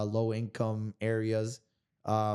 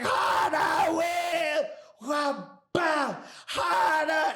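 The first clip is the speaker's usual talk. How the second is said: yelling coach; much slower than usual. Retold or imitated. Fighter retelling his coach yelling at him during pad work, high shout. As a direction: scream it like a coach driving you at the pads. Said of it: "And then he's like".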